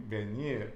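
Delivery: "Venir" stressed on its last syllable, NIR; final-syllable stress. Shown as a demonstration. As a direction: lean on the end of "Venir". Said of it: "Always at the end".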